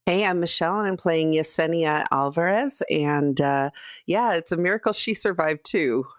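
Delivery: ordinary talk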